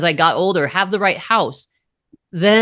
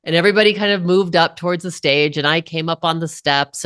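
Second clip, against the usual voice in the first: ordinary talk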